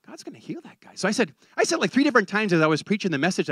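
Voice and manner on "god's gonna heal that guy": hushed, private realisation; very soft, to yourself; inward thought. Retold or imitated. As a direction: think it aloud, very softly. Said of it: "I thought".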